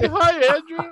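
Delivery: high, loud sing-song group hello; imitated group greeting. Imagining co-hosts greeting the listener, high sing-song hello. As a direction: chime in like a cheery chorus. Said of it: "I thought you guys were gonna be like".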